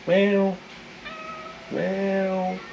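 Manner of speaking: high playful meow; meowing at the cat. Pretend. Coaxing the cat, high mock meow.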